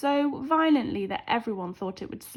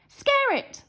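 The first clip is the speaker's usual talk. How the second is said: high, loud holler; 6 dB louder than usual. A narrator's reading.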